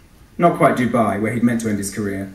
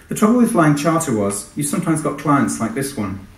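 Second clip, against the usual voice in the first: ordinary delivery, nothing marked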